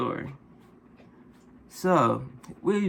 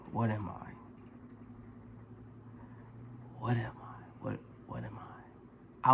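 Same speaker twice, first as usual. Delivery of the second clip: put-on much softer, low slurred drunk mumble, fading to a whisper; 4 semitones lower, 16 dB quieter than usual. Drunk stranger asking the narrator's race, soft slurred mumble. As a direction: mimic a drunk man mumbling the question.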